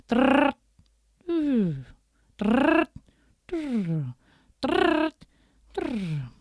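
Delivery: rolling rrr ring; buzzing rolled-r phone ring; rolled trrrr phone ring. Acted. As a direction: ring like an old telephone. Phone ringing sound.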